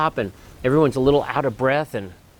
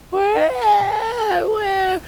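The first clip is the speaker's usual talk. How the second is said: put-on very high wailing child cry; hammed-up wah wah crying; mock bawling; 3 dB louder than usual. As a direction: imitate a kid sobbing loudly. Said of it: "he's crying".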